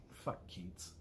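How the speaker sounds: under-the-breath curse at the poet; muttered curse. Real, not acted. Reading a tricky poem, she mutters a curse at Keats.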